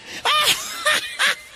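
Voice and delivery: put-on gloating childish laugh; triumphant laugh. Laughs after beating shadow.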